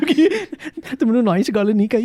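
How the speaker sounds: high, loud teasing jab; mock her sweetly; playful teasing. Real, not acted. Teasing her as nice girl.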